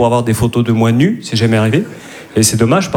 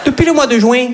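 put-on much higher, sing-song Midi accent for a romcom; southern French accent; 10 semitones higher than usual. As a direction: play a New York romcom scene with a broad southern French accent. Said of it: "T'imagines une comédie romantique à New York, avec l'accent du Midi?".

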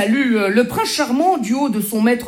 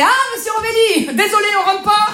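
polite bouncer; 9 semitones higher than usual. Retold or imitated. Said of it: "Maintenant, c'est".

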